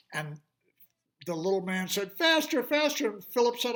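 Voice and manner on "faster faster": high, louder urging; urging fairy. Acted. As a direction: hurry him on. Fairy urges.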